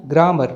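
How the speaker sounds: ग्रामर as the form to avoid; wrong pronunciation. Shown as a demonstration. Teacher shows learners what to avoid, r pronounced. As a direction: say ग्रामर with its final r sounded. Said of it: "ना कि".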